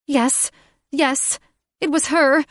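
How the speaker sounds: high, loud, frantic accusation; Catherine accusing. Acted. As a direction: shriek the accusation at him.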